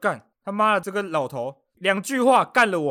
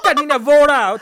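much higher and louder mock yell; imitated cursing shout; 8 semitones higher, 11 dB louder than usual. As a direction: bellow the old man's curse. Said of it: "就一阵吼叫声". (模仿老头破口大骂)

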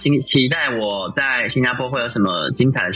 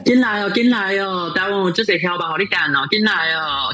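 street hawker call; 6 semitones higher than usual. Acted. Calling people in like a hawker.

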